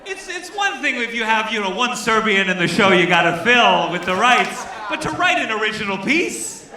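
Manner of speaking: high-pitched, incredulous comic outrage; appalled protest. Real, not acted. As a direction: build in disbelief to a rising question. Host appalled, protests the play.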